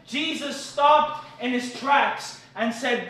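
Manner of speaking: ordinary delivery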